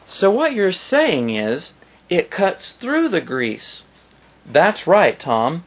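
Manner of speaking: slow, high cheesy infomercial voice; infomercial host. Retold or imitated. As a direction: sell it like a fake TV pitchman. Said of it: "the infomercials".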